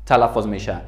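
usual speaking voice

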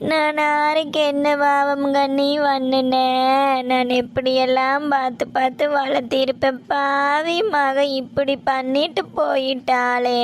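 slow, drawn-out crying lament; tearful wailing. Acted. Mother lamenting the daughter who left, wailing in tears. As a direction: wail it out, stretching the line endings into sobs. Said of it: "நீ அழுகிறத பார்த்து".

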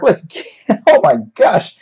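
a real burst of laughter at a botched paraphrase; laughing. Real, not acted.

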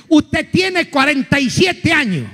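slow, deliberate pronouncement; grandma slowly announcing his age; slow verdict. Acted. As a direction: deliver the punchline slowly. Old lady verdict.

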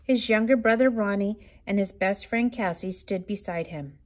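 ordinary delivery, nothing marked

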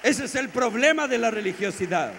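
high, full-voiced sermon shout; loud, impassioned declaration; shouted preaching. Real, not acted. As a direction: cry out the verdict to the congregation. Preacher shouts the conclusion, then repeats.

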